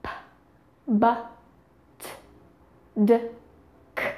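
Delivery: p, b, t, d, k as short released bursts; p, b, t, d, k one by one, with pauses; stop consonant sounds. Shown as a demonstration. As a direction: pop each isolated sound: p, b, t, d, k. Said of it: "The stop consonants include".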